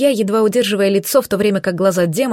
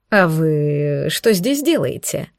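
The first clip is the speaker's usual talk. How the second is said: slow, lower surprised male voice; 5 semitones lower, much slower than usual. Acted. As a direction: draw it out in surprise. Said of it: "удивился он".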